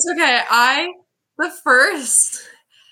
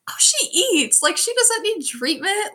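higher-pitched mock reassurance, slightly drawn out; imitating hospital staff; 9 semitones higher, slower than usual. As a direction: lightly mimic staff brushing it off, voice lifted high. Voicing dismissive hospital staff.

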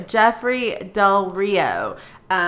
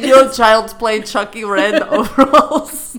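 louder, laughing through the words; 9 dB louder than usual. Real, not acted. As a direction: giggle the words out. Laughing at the red overalls.

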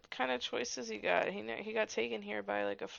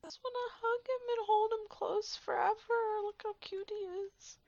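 gushing; 13 semitones higher, 5 dB louder than usual. Real, not acted. Gushing at a cute character.

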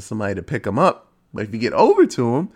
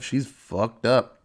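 usual speaking voice

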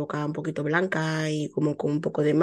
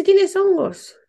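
high, louder mock doctor asking; imitating the doctor, much higher and louder; mimicking the doctor; 12 semitones higher, 6 dB louder, much slower than usual. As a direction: put on the gynecologist's voice, pitched up. Retelling the gynecologist's question.

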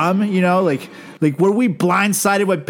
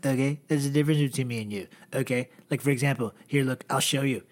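lower, softer Trump voice with okays; Trump impression; 5 semitones lower, 5 dB quieter, faster than usual. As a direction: boast low and smug like Donald. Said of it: "Then Donald's like".

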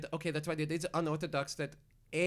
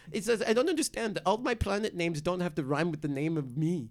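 normal talking voice

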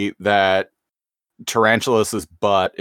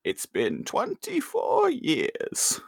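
slow, soft, higher villain purr; gloating villain; 11 semitones higher, 5 dB quieter, much slower than usual. Acted. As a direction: savor the long wait.

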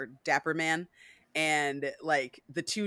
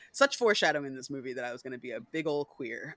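ordinary talk

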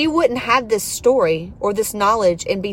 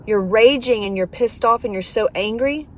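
ordinary talk, nothing marked

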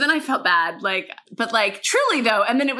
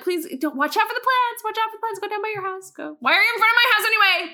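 exasperated pleading; 10 semitones higher than usual. Retold or imitated. Neighbour begging kids to mind the plants, exasperated. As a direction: plead with them, voice climbing in exasperation.

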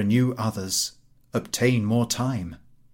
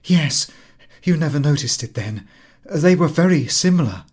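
louder, higher, proud and ingenuous; proud joy; 5 semitones higher, 7 dB louder than usual. Acted. Mathilde proudly telling her friend, louder and higher. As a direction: beam with naive pride. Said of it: "she smiled with a joy that was at once proud".

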